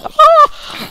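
sudden high scream; a startled shriek under attack. Real, not acted. Gamer screaming as mobs close in, shrill shriek. Scream while surrounded in game.

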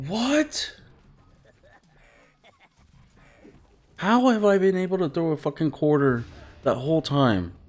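high, loud incredulous complaint; real exasperated gamer rant; outraged disbelief. Real, not acted. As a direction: protest the unfairness, pitch riding high. Outraged at dying in game.

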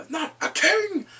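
high, loud mock proclamation; loud boasting. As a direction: shout it like a rowdy toast. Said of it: "he is boasting him".

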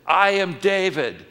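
loud, high, slow menacing declaration; mock menacing threat. Preacher playing himself as Joseph, threatening the brothers loudly. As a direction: boom it out like a vengeful avenger. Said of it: "'cause I would have said".

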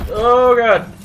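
alarmed shout. Real, not acted. Dying in game.

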